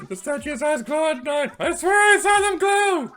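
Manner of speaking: high, loud, breathless shouting; excited shout. Acted. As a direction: yell it up high, wide-eyed. Excited character calls out to Marina.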